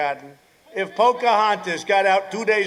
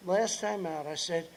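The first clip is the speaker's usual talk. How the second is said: ordinary talk, nothing marked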